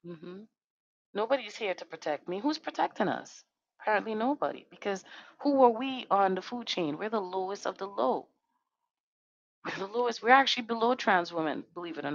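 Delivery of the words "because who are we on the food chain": ordinary delivery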